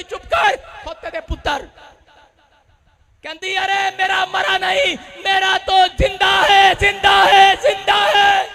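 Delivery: high sermon voice building to a shout; insistent, rising to loud repeated declarations; impassioned preaching. Real, not acted. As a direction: preach the mother's defiant denial. Preaching a defiant mother's words.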